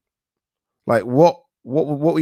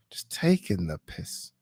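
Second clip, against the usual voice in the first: slow, softer whispered aside; a real hushed slow aside to the camera; 4 semitones lower, 9 dB quieter, slower than usual. Real, not acted. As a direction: say it under your breath, slowly, for yourself. Muttered aside after reading comment.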